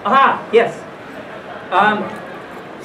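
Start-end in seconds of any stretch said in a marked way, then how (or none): none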